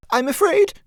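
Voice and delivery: a client faltering before her dressmaker, pitched high. A narrator's reading. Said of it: "she faltered".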